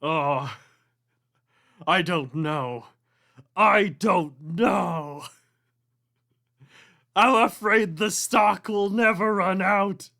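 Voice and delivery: high, loud wailing ghost. Acted. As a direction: wail the answer desperately.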